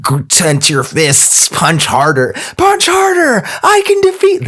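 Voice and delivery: rising to a high yell; hammed-up anime battle cry; overacted anime dub. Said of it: "from desperate anime character".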